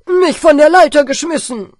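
loud angry roofer; scolding. Acted. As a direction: rant at the boy. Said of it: "schimpft der Dachdecker".

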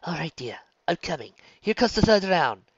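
put-on brisk encouraging older woman; midwife voice. A narrator's reading.

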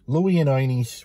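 Bronx pronunciation, "Ernie's" as "Oynie's"; demonstrating Bronx speech with "Oynie's"; Bronx accent. Shown as a demonstration. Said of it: "And so, therefore".

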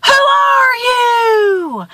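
very slow, high holler; stretched-out high shout; screamed question. A narrator's reading. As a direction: call across a canyon to a stranger. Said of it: "And he screams".

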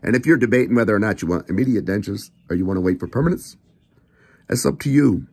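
ordinary delivery

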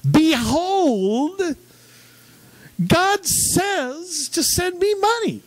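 exaggerated revival preacher cry; mock televangelist. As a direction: ham up a preacher asking for money. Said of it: "I just remember the".

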